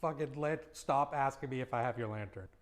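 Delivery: normal talking voice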